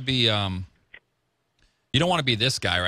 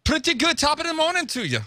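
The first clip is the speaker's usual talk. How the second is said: mock Irish greeting. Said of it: "I like your accent".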